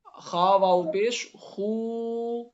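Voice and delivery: "خو" as a long, drawn-out "khuu"; slow spelling, ending on a held "oo" in "خو"; spelling out maruf waw. Shown as a demonstration. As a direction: spell it slowly, stretching "خو" into khuu. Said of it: "معروف پڑھا جائے گا".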